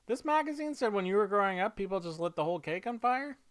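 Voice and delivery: put-on high-pitched, quick kid asking a question; curious kid. Kid asking Mom about burning cakes, high quick child voice. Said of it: "then kids will be like".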